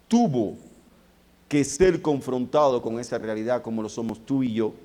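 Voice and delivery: ordinary talk, nothing marked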